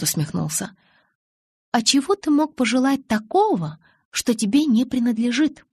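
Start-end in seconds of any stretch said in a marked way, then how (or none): 1.7-5.6 с сомнением. Acted. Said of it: "сомнением поинтересовалась я".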